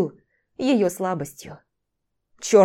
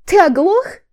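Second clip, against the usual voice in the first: high, loud strained exclamation; desperate, outraged shout; voice breaking; 10 semitones higher, 8 dB louder, slower than usual. A narrator's reading. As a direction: cry out, voice about to crack. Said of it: "воскликнула Виктория срывающимся голосом".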